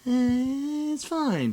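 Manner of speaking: high, bored little "Eh, it's fine"; a hint of shrugging indifference; mock unimpressed. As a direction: lightly imitate her meh verdict. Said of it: "We gotta turn that".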